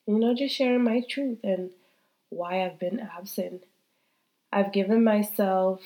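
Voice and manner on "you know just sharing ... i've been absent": normal talking voice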